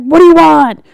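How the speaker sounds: a bro barista barking the order; intense bro barista. Retold or imitated. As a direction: bark it like a pushy bro. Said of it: "intense ninja".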